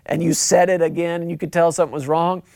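ordinary talk, nothing marked

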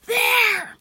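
very loud, high yell; urgent, piercing call. A narrator's reading. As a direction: yell to point out a landing spot. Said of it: "Zia yelled".

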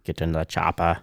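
put-on a passing stab at an Austrian action-hero accent; Arnold Schwarzenegger impression. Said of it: "We should do a podcast only on accents".

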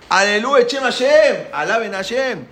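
high, loud praise; jubilant proclamation. Acted. Freed people praise Hashem, jubilant proclamation. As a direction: cry out the praise with rising force.

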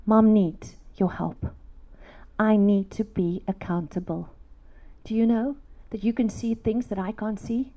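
low, soft, gentle plea; earnest confiding. Retold or imitated. Mom asking her son on her lap for help, gently earnest. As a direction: confide humbly in a child, unhurried.

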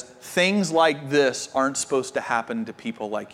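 low, soft retelling of a tearful friend; lightly voiced friend fighting back tears; tearful quote. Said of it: "in tears looks at him and says".

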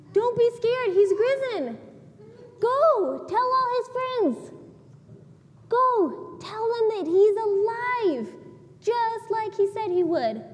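angel proclaiming good news to the women; angel's announcement. Retold or imitated. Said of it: "guess what the angel said".

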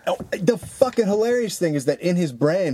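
ordinary delivery

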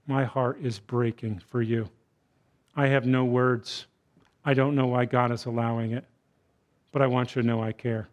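soft, tender consolation; comforting. Shown as a demonstration. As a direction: speak it like an arm round a grieving friend. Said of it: "put your arm around them and say".

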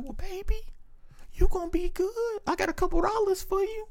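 high, soft grandma voice; grandmother voice. Retold or imitated. Grandmother offering her grandson a couple dollars. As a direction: offer money like a doting grandma. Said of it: "And she'd be like".

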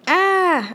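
high, sharp mouse squeak of fright; startled squeak. Acted. Mouse frightened by the sneezing cat, high squeak. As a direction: squeak in sudden alarm. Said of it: "I squeak".

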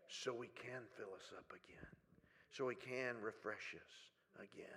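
hushed, breathy whisper. Real, not acted. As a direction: drop to a whisper. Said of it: "whispered line in sermon".

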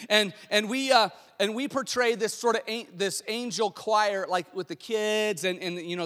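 normal talking voice

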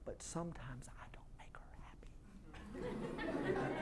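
whispered, slowed confession; hushed admission; confessional whisper. Real, not acted. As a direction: breathe it like a secret. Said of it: "you may not even believe this".

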